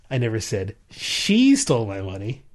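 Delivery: she lifted and leaned on; stress on SHE. Shown as a demonstration. Demonstrating emphasis on she to a co-host. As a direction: hit the word she. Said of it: "based on what word you put emphasis on".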